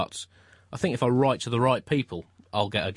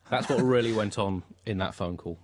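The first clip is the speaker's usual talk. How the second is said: ordinary talk, nothing marked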